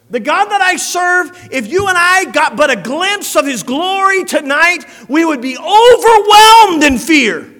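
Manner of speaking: loud, high, full-force preaching. Acted. Preacher proclaiming God's glory to the church, full-force preaching. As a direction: thunder it out to the congregation.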